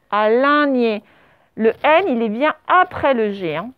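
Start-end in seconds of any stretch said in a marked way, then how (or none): 0.1-1.0 ALIGNÉ said as a-lain-gné, nasal middle; mispronunciation. Shown as a demonstration. Teacher warns pupils what misplaced N gives, mispronunciation. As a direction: put AIN in place of I in ALIGNÉ. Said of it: "sinon ça fait".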